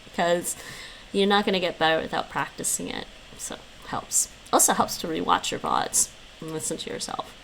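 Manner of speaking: soft unvoiced whisper, a little fast. Real, not acted. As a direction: lean in and whisper it. Whispered asides to chat.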